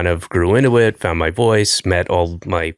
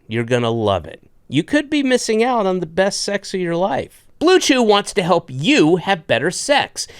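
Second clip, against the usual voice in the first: upbeat host ad read, pitched up; 6 semitones higher than usual. Acted. Podcast host reading sponsor ads, upbeat ad voice. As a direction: sell it like a podcast sponsor spot.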